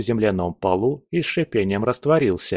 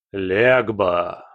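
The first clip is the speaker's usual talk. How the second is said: sepulchral voice. Acted. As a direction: boom it from beyond the grave. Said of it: "Замогильный голос".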